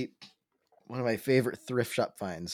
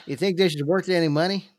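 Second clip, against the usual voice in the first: lightly put-on higher finder voice; old-timer finder; 6 semitones higher, 4 dB louder than usual. Finder asks Jimmy about the bullet's worth, mock role voice. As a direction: play a hopeful finder, hammed up. Same improvised finder character.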